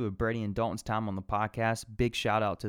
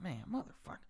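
much softer grumbled curse; softer, fed-up aside; muttered curse; 6 semitones higher, 11 dB quieter than usual. Retold or imitated. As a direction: grumble under your breath. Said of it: "And I'm like".

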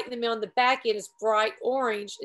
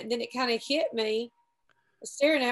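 ordinary delivery

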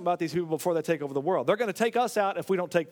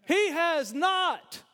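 a raised preaching voice, slowing on key words; 5 semitones higher than usual. Acted. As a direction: proclaim it with full force. Preaching the prophecy with force.

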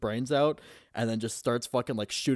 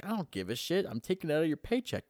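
higher-pitched, quick retold brush-off; mock dismissive boss; 7 semitones higher, faster than usual. Boss docking his paycheck, mock dismissive. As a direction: brush off the excuse, imitating a boss.

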